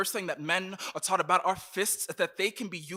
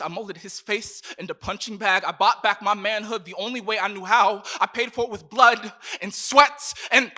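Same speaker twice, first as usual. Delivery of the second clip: building intensity; 2 semitones higher, 8 dB louder than usual. Acted. Fight scene, building up.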